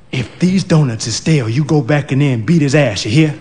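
ordinary delivery

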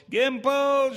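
high, anguished cry of a name; the wife in labour cries out to her husband, high and anguished. A narrator's reading. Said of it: "she cried".

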